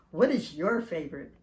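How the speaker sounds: ordinary delivery, nothing marked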